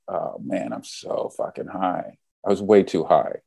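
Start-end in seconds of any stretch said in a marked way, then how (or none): 0.1-2.2 whispered and slow, soft; soft whispered aside, slowed down; stoned whisper. Retold or imitated. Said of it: "I was like".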